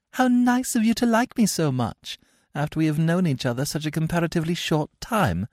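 put-on a touch of girlish politeness; lightly voiced young girl. Cecily voiced in read play.

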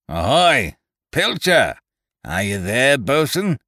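loud, high call across the water; hailing shout. A narrator's reading. Castaway hailing the ship from the beach, loud high shout. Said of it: "came down to the water's edge and hailed us".

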